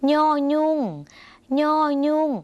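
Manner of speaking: ย่อยุ่ง held high and level with a nasal onset; nasal pronunciation. Shown as a demonstration. Teacher demonstrating the Lao letter to viewers, nasal ย่อยุ่ง. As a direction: say ย่อยุ่ง slightly through the nose, high-pitched. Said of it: "เสียงขึ้นจมูกนิดนึงนะคะ".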